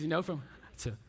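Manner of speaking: ordinary talk, nothing marked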